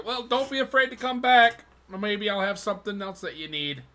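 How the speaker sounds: a high, eager salesman's goodbye; chirpy merchant voice. Acted. Merchant thanking the player for his business, high and eager. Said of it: "he tells you".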